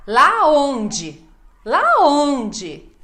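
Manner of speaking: loud "onde", high swell then fall; emphasis at the end. Shown as a demonstration. Teacher demonstrating question stress, loud high "onde". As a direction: punch "ONde" loudly and let it drop. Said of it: "ênfase no final".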